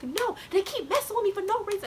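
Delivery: high-pitched aggrieved complaint; upset whining. Retold or imitated. Student complains to teacher, upset whining. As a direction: imitate a whiny, worked-up tattle.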